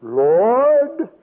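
drawn-out southern "Lord"; little southern boy. Retold or imitated. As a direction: imitate a southern child starting his prayer. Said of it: "He's a little southern boy".

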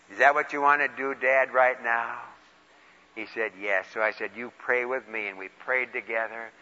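soft, quiet voice at the bedside; hushed and tender. Retold or imitated. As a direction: keep it low and gentle. Praying with dying father.

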